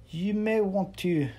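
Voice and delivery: lightly voiced Yvette starting a warning; Yvette's voice. Yvette warns narrator.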